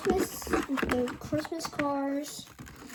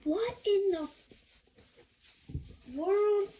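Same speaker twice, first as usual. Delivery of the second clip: surprised; 8 semitones higher than usual. Real, not acted. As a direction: ask in shocked disbelief. Box refusing to open.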